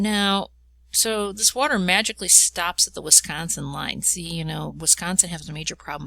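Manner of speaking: ordinary delivery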